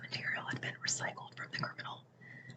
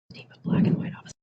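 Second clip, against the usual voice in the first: fast, loud, fully voiced; sudden loud outburst; 18 dB louder, much faster than usual. Real, not acted. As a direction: burst out loud, rushing the words. Sudden repeat, much louder.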